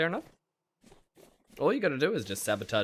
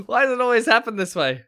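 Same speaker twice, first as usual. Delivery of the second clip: exasperated; 11 semitones higher, 11 dB louder than usual. Real, not acted. Exasperated after the attack.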